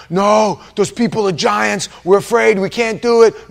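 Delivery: loud, high cry of alarm; hammed-up frightened refusal; mock panicked protest. Preacher as spies afraid of giants, loud mock panic.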